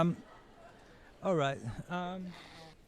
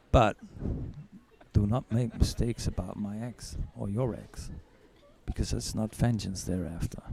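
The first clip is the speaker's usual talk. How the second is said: cool menace; 8 semitones lower, 5 dB quieter than usual. Acted.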